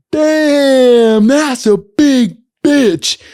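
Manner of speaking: put-on wasted drunk guy. Drunk buddy on a street lamp hollers at the tall woman, drawn out and loud. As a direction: drag it out like a drunk clinging to a lamp post. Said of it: "He was so wasted, holding onto a street lamp going".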